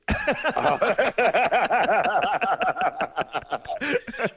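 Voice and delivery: real helpless laughing fit; high, breathless real laughter; long laugh. Real, not acted. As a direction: laugh too hard to read on. Can't read rest of text.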